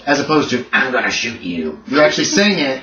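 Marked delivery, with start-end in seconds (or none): 0.7-1.8 lower, softer, slow character delivery. Shown as a demonstration. Game master showing players how in-character speech sounds, low character voice. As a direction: say it in character, dropped low and drawn out. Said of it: "speaking in character".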